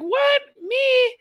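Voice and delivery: squeaky high fake innocence; innocent shock. Son feigning innocence to his mother, squeaky high denial. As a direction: play dumb in a high squeal. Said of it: "David's like".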